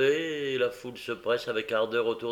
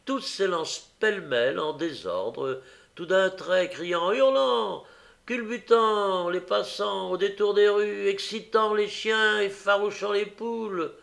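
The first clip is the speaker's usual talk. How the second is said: excited narration; 8 semitones higher, 3 dB louder than usual. A narrator's reading. Narration of a wild chase.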